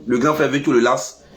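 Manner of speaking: ordinary delivery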